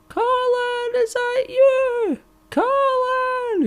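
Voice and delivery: very high, far-off calling voice of his mum. Retold or imitated. Storyteller voicing his mum calling out to him. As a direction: call his name like a mum hidden in the dark.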